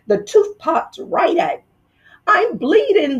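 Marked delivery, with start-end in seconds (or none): 2.3-3.2 high, startled yelp. Acted. Fox waking from the gas, yelping in alarm. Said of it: "the fox yelped".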